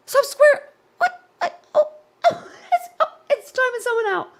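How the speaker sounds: high, gasping, real laughing outburst; gasp, then blurt it out through laughter; shocked laughter. Real, not acted. Streamer sees bot time someone else out, shocked laughter. Bot times out another viewer mid-sentence.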